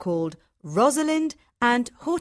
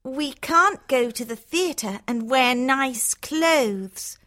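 sulky Hortensia; 4 semitones higher, slower than usual. A narrator's reading. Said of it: "says Hortensia".

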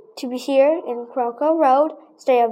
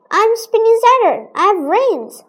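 Saturn in a play; 6 semitones higher than usual. Acted. Child acts planet in class play.

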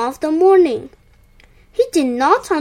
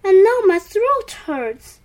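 higher-pitched plaintive complaint; groaning; 6 semitones higher, 4 dB louder than usual. A narrator's reading. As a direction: groan about a sore throat, lightly. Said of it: "groaned Cow".

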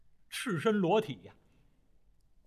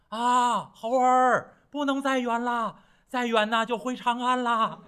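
higher, louder call into the distance; calling out loudly; 7 semitones higher, 4 dB louder, slower than usual. A narrator's reading. Xuanzang calling back to the monkey far away, raised voice. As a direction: shout after the far-off monkey. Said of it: "玄奘法师高声喊嚷".

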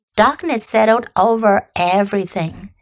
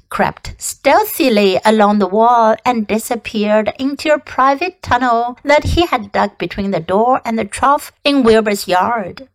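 ordinary delivery, nothing marked

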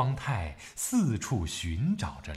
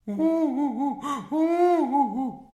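high, slow boohoo wailing; child sobbing; slower than usual. A narrator's reading. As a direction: sob like a small child. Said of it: "哭着跑了".

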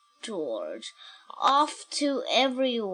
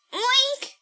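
pig oink; 14 semitones higher than usual. Acted. George oinks.

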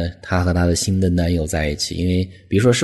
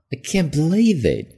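high, astonished "I can't believe it"; disbelief; 9 semitones higher than usual. Acted. Teacher acting out shock at the celebrity split. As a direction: sound like you can't believe the news. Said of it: "注意这种语气啊".

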